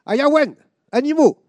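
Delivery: very high, loud bellowed call; shouting orders. Retold or imitated. Officers calling soldiers from the balcony, bellowing. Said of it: "en criant".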